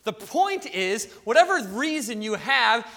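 usual speaking voice

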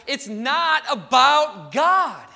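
loud, slow, each word driven home; emphatic preaching. Real, not acted. Preacher telling church what their reasons miss, emphatic.

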